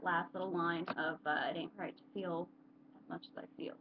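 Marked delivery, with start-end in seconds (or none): 1.5-3.7 quiet quote. Retold or imitated. Vlogger recalling the line that spoke to them, hushed. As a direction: murmur the remembered line, almost to yourself.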